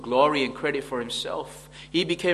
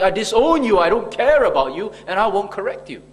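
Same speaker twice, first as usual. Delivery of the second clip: a louder, higher, cold disowning voice; mock disowning father; 4 semitones higher, 6 dB louder than usual. Preacher voicing an uncaring father, raised and dismissive. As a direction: play the father who brushes off his child.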